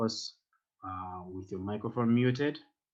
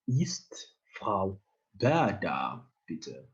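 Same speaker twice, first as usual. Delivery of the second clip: slow model reading; 2 semitones lower, much slower than usual. Shown as a demonstration. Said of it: "just try to read after me".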